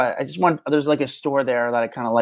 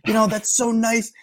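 high, delighted thank-you in a borrowed voice; 9 semitones higher, slower than usual. Retold or imitated. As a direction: play a flattered star gushing thanks, pitched up. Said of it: "He's like".

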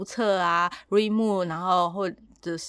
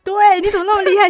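much higher and louder, caught off guard; surprised exclamation; 14 semitones higher, 13 dB louder than usual. Real, not acted. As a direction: blurt it out, amazed she noticed. Caught out near end of show. (惊讶地高声惊呼)

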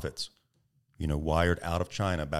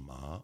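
soft "Ma" rising at the end; "Ma" lifted from a low start; questioning second tone; 4 semitones lower, 10 dB quieter than usual. Retold or imitated. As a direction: ask "Ma" as a question. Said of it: "like you're asking a question".

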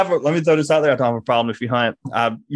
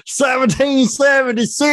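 higher, slow, hammed-up patriotic boast; mock patriotic proclamation; 10 semitones higher, 3 dB louder than usual. Cohost hams up a patriot joke. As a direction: declaim it like a proud patriot.

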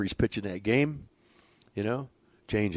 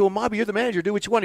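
raised retort; 11 semitones higher, 4 dB louder, faster than usual. Retold or imitated. Coach snapping back at manager.

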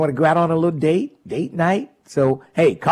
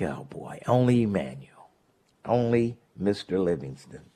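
muttered aside; 8 semitones lower, 9 dB quieter than usual. Retold or imitated. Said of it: "I'm like".

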